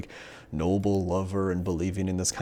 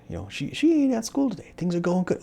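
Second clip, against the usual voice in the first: relieved inner voice; 12 semitones higher than usual. Retold or imitated. Nervous boy reassuring himself.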